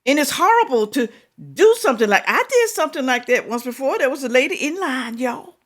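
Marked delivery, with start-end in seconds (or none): none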